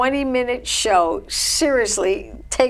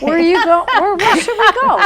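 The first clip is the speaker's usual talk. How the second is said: laughing; 8 semitones higher, 5 dB louder than usual. Real, not acted. Excited laugh about food.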